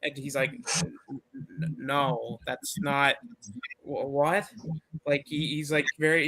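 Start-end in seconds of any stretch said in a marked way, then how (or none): 0.8-4.5 soft, stammering comic disbelief; mock flustered. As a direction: stumble quietly through a baffled denial, acted. Retold character baffled by the accusation.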